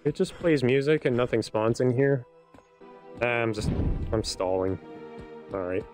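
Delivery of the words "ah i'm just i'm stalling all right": ordinary talk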